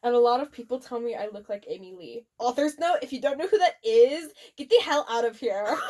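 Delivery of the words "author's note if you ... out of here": ordinary talk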